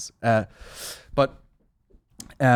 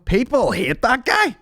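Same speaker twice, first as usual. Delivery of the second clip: "People hate that guy"; 4 dB louder, slower than usual. Acted. Drawn-out emphatic mock voice.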